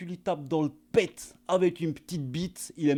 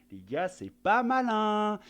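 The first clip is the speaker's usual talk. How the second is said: mocking; 7 semitones higher, slower than usual. Mocking the Russian pilot.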